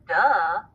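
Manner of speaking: "duh," a d plus a short "uh" vowel; added schwa. Shown as a demonstration. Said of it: "D sound transformed into".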